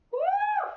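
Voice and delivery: a very high, loud whoop; excited woo. Real, not acted. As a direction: cheer them on with a whoop. Instructor cheering the class.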